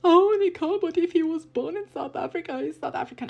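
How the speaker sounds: high, loud sneering imitation of a naive critic; mock them in a high, bratty voice; mocking whiny opponent.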